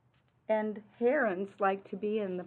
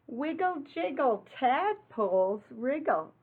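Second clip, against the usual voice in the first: bouncy read-aloud rhyme; 5 semitones higher than usual. Acted. Reading rhyming picture book aloud.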